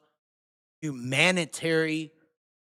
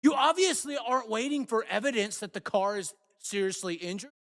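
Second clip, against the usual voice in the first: normal talking voice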